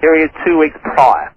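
ordinary talk

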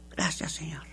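hushed whispered thanks to the Lord; whispered prayer. Retold or imitated. She recalls thanking the Lord in prayer, whispered. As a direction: breathe it like a private prayer. Quoting her own prayer to God.

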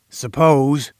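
drawn-out, pondering word; said slowly. A narrator's reading. Boss testing the captive, speaking slowly. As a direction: stretch it a little, weighing the idea. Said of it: "he said slowly".

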